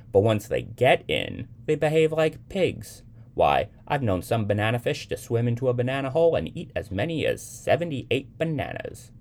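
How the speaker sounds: lightly voiced young man spinning a yarn; playful storyteller. A narrator's reading. Young man telling Sybil about bananafish, mock-amazed. Young man's tall tale to child.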